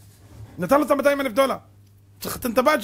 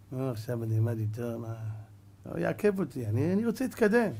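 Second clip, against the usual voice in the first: dismissive refusal; 8 semitones lower, 7 dB quieter than usual. Acted. Student refuses study partner.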